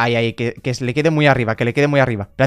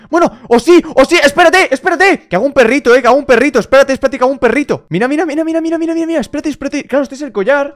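high, loud eager chatter; excited; 10 semitones higher, 6 dB louder than usual. Real, not acted. Gamer realising he can build a dog, excited. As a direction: bubble over with a new idea.

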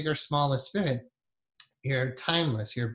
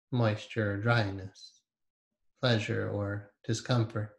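guided meditation. Acted. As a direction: ease them inward with long pauses.

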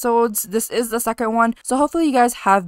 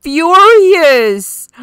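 high, drawn-out cry of astonishment; loud exclamation; 8 semitones higher, 11 dB louder than usual. Real, not acted. Game player reacts to a sentiment on screen, loud exclamation. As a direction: blurt it out at full volume.

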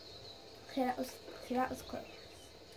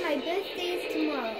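ordinary talk

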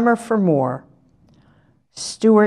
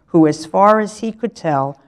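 usual speaking voice

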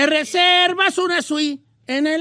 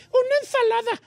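put-on dainty girly voice; 11 semitones higher than usual. Mocks a dainty date ordering.

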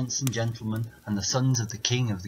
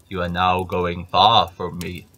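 loud, slow giant; suspicious giant calling out; 2 semitones lower, 7 dB louder, slower than usual. Acted. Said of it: "says the giant".

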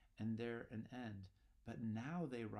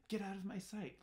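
much higher frightened cry; panicked outburst; 11 semitones higher, 3 dB louder than usual. Retold or imitated. Macbeth yells at Banquo's ghost, high panicked cry. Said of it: "then he's like".

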